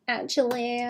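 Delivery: put-on Valley Girl whisper "actually". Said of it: "in the Valley Girl voice".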